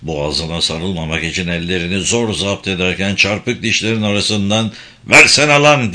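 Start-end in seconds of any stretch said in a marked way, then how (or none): 5.1-5.9 loud, higher, angry demand; angry hiss. A narrator's reading. Hikmet demands the paper from the hesitant young officer, angry hiss. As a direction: burst out with it, barely holding back rage. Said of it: "diye tısladı".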